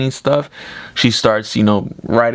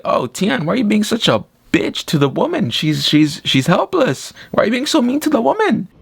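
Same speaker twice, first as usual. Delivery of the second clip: high-pitched indignant pleading; exasperated protest; 11 semitones higher than usual. Retold or imitated. Viewer scolding Tian for meanness to her, exasperated high protest. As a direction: protest in a voice climbing higher and higher.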